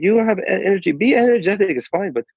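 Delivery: high-pitched imitation of a Persian club owner's accent; Jamie Masada Persian accent. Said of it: "by the way, he's Persian".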